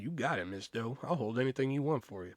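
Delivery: soft, low smooth-talker; smooth flirty voice. Retold or imitated. Clerk flirting softly with his crush. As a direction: purr it to the woman you fancy.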